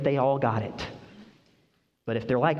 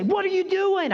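mock outrage; 3 dB louder than usual. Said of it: "I didn't say".

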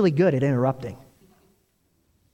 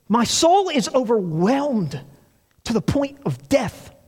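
higher, slow, anguished; with such emotion; 6 semitones higher, slower than usual. Retold or imitated. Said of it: "with such emotion".

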